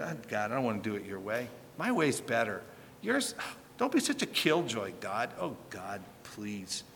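mock petulant sinner pleading to God; mock whiny sinner. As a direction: whine at God like a spoiled child. Voicing a sinner whining to God.

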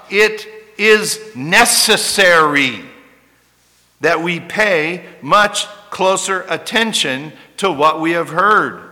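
loud, slow pulpit proclamation; preacher urging the church to pay attention; forceful preaching. Acted. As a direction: proclaim it like a warning. Preaching the verse at full force.

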